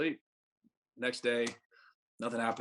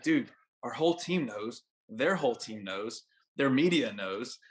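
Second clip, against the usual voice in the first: slow, deliberate words driving it home; emphatic; slower than usual. Retold or imitated. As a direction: spell out how bad it is, slowly. Catcher spelling out the scandal.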